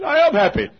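high, loud retold reply, laughing; emphatic happy shout. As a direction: shout it back through laughter. Retells his laughing, loud reply.

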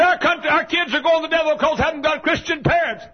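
high, loud whiny voice of a grumbler; mock complainer. Pastor mimicking a church member's complaint, high and loud. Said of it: "You say".

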